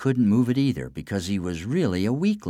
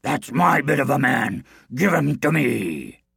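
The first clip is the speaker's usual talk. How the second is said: demanding giant; 5 semitones higher than usual. Acted. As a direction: claim your prey. Man among men claims him.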